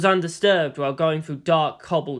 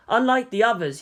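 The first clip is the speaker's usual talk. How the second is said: usual speaking voice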